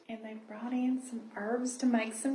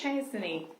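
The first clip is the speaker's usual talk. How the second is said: plain reading voice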